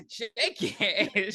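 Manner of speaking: giggling as you drawl it out; laughing. Retold or imitated. Repeating quote while laughing.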